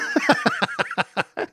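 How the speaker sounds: high-pitched cackle, soft and rapid; real high laugh. Real, not acted. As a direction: burst into a high giggle. Cracking up at co-host's remark.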